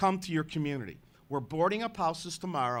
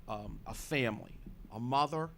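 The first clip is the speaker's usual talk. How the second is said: ordinary talk, nothing marked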